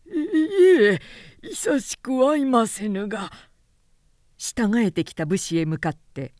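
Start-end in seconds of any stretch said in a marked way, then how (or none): 0.1-3.3 frightened stammer. Acted. Trembling official denies meeting Ji Ping.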